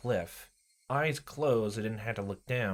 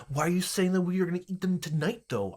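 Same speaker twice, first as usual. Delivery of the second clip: panicked; 9 semitones higher, 4 dB louder than usual. Acted. Frightened protest.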